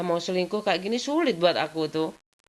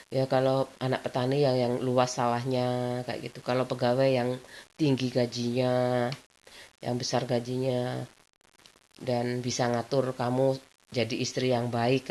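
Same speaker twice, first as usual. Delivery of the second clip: slow advice; 4 semitones lower than usual. Retold or imitated.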